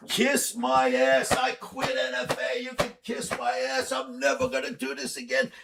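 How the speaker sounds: high, slow, taunting mock voice, pitched well up; mocking kiss-off. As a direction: jeer it at them like a cheeky kid. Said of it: "I'm making fun of them".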